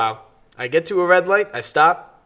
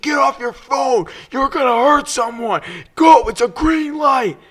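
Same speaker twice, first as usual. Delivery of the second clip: screaming driver; 9 semitones higher than usual. Retold or imitated. The BMW driver screaming at her from his window, hammed-up. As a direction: rant at her like an angry stranger. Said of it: "He's like".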